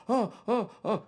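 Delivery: high, mocking "oh, oh, oh" of an eager man; mock eager heckler. Comedian mocking the heckler who stood up, high mock voice. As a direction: ham up the guy leaping to his feet.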